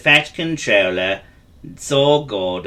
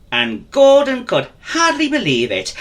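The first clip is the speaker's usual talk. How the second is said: ordinary delivery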